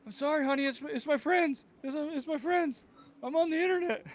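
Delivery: sheepish husband excuse. Retold or imitated. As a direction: plead with the wife meekly. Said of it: "excuse to wife, retold".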